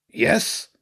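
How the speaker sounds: high-pitched agreeing rat; Zen. Acted. Rat backs up his cousin's excuse, high eager voice. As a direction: chime in as a defensive rat.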